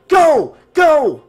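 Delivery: loud, high urgent shouts; crying out. A narrator's reading. Nick urges Tina on as Jason blocks them, crying out. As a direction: yell each word out hard and high. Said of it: "Nick cried".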